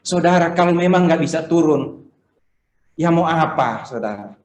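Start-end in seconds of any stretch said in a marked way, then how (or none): none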